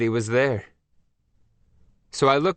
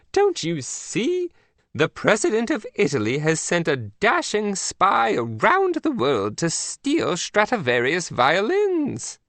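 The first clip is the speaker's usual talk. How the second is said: slow, higher regal queen voice; a prim queen breaking bad news; Queen of England; 8 semitones higher, slower than usual. The Queen scolds agent Mac by phone, prim.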